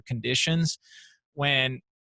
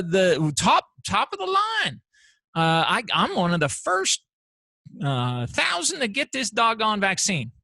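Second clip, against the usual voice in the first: mock lottery winner; 5 semitones higher than usual. Mocking eager vaccine lottery winner.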